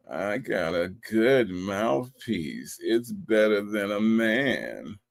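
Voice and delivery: low, soft, very slow mock seduction; mimicked sexy drawl. Host re-enacting her boast for Dimples, mimicked sexy drawl. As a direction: imitate her, dragging out each word suggestively. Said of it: "she thought that was a sexy thing to say".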